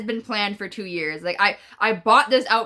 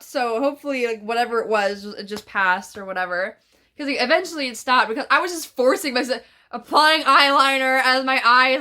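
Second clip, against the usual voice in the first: ordinary delivery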